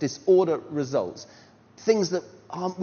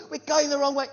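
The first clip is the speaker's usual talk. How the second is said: higher, louder mock panic; panicked thought; 11 semitones higher, 4 dB louder than usual. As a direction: replay the fear, half laughing.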